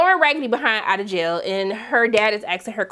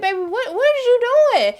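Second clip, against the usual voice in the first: rising higher in mock disbelief; incredulous question; 11 semitones higher than usual. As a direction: ask like you can't believe her. Questioning a character's antics.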